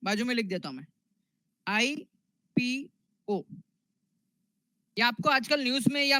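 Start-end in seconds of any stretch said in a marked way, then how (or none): 1.7-3.4 letter by letter, long gaps between; spelling out slowly. Real, not acted. Teacher writing the term beside it for students, spelling out slowly. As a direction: spell it out unhurriedly for note-takers.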